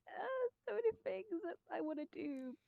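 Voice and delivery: high, soft mock lament; mock overwhelmed. Voice actor quoting own indecision about projects, mock overwhelmed. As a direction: play up being torn, pitched high and light.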